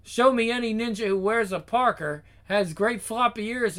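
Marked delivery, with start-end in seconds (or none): none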